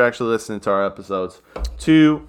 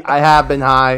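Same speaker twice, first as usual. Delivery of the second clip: ordinary delivery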